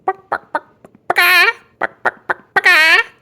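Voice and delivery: high, loud mocking cluck; chicken clucking. A narrator's reading. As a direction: cluck like a strutting chicken to taunt. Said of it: "flapping his arms like a chicken and clucking".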